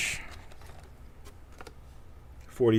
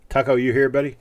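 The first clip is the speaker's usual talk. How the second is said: calling out; 3 semitones higher, 7 dB louder than usual. Real, not acted. Calling out for absent viewer.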